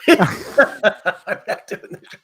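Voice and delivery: laughing echo. Retold or imitated. Laughing mock repeat.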